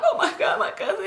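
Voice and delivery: high-pitched mock crying, half laughing. Girl searching for her cousin after shots, acted high crying. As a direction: whimper it high in pretend panic. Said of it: "I'm crying. I'm like".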